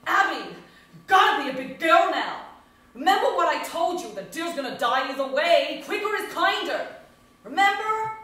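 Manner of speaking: loud, firm and high, commanding; stern uncle. Retold or imitated. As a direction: order a child to be brave. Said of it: "uncle voiced in retold scene".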